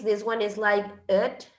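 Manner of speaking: normal talking voice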